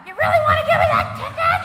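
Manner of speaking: high, loud, fast outburst; mock incredulous yell. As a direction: shout it at the cop in comic disbelief. Acted plea to a traffic cop.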